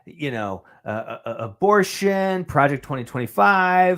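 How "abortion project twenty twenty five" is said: higher, drawn-out parroted buzzwords; mock slogan repeating. Co-host imitating convention speakers repeating their talking points, mocking. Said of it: "they just say".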